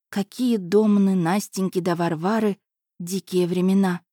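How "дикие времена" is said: usual speaking voice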